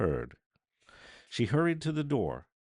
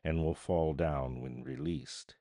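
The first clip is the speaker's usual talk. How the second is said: solemn declamation; 4 dB louder than usual. A narrator's reading. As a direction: proclaim it like an epitaph. Unseen voice pronouncing over the dead.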